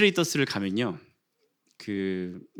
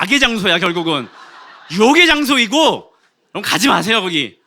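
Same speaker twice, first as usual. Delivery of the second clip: comic emphasis; 6 semitones higher, 8 dB louder than usual. Real, not acted.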